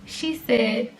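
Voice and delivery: ordinary talk, nothing marked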